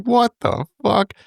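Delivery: high, slow shocked gasp of a question; mock shocked outcry; shocked ladies. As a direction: play the ladies seeing blood. Ladies shocked at bleeding man.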